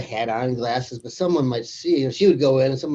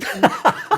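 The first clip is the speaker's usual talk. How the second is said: high laugh; 13 semitones higher, 5 dB louder than usual. Real, not acted. Laughs at own joke.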